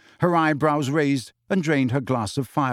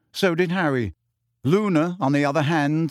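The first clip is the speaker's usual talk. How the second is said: ordinary delivery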